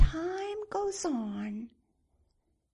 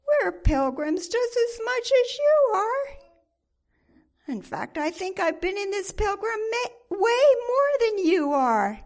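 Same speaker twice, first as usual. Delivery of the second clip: indignant retort; 7 semitones higher, 6 dB louder than usual. Acted. Presumption takes offence.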